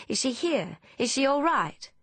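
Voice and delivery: high, eager relieved questions. A narrator's reading. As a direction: ask with relief, pitch jumping up then falling. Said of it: "Hannah exhales with relief".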